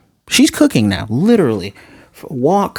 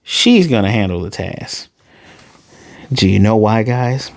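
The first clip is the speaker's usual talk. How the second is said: ordinary talk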